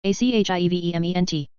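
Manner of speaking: quick letter run; brisk recap spelling; fast spelling. Shown as a demonstration. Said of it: "spelling drill, slow then fast".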